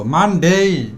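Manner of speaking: মানডে with the final "dei" held long; drawn-out day. Shown as a demonstration. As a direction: linger on the last syllable of মানডে. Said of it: "একটু টেনে বলবো". (মানডে টেনে, শেষে লম্বা "ডেই")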